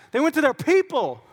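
PEOPLE swooping high, then falling; emphatic delivery. Shown as a demonstration. Preacher showing the congregation how to say it, PEOPLE swooping high. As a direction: punch "people" high with relish. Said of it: "you gotta say it like that".

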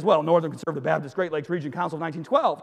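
brisk, settled reply; matter-of-fact answer. Acted. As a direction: reel off the long name quickly. Jumper's answer to the question.

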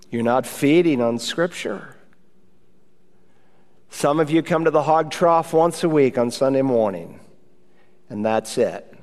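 ordinary delivery

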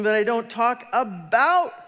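high, loud and slowed; preaching emphasis. Real, not acted. Preaching to the congregation, slow and loud. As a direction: drive home the point.

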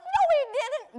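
high, loud No, he didn't; mock retort. Preacher teasing about the ugly dance, high mock retort. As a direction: snap back in playful disagreement. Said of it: "I'm like".

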